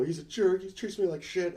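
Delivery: mock weepy. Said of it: "she's crying".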